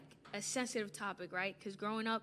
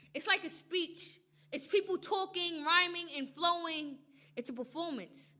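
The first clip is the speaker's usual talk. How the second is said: spoken word performance; 5 dB louder than usual. Acted. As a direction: slam poetry delivery.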